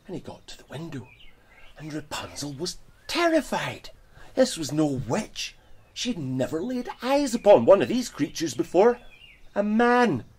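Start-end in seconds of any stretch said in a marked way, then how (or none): 1.8-10.3 loud, high, building storyteller drama; dramatic excitement. A narrator's reading. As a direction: build it up to the big reveal. Dramatic reveal, rising excitement.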